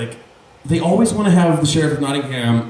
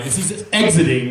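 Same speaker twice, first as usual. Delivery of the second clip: ordinary talk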